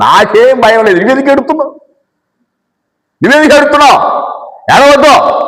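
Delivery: high-pitched, insistent mock scolding; imitated urging. Wife tells her husband not to cry, insistent. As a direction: act out urging someone to stop crying.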